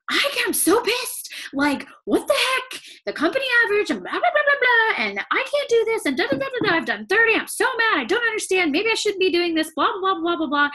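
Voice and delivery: high, frustrated venting in a put-on whine; mock angry rant. Sister venting to Connie about thirty no's, high mock rant. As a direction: rant like a fed-up sister who can't get a yes. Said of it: "she was like".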